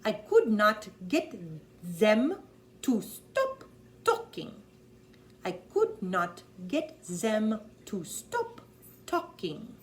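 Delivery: exasperated German accent. Shown as a demonstration. Coach runs the witness line in a German accent. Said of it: "They say a Z or an S".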